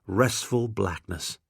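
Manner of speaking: ordinary delivery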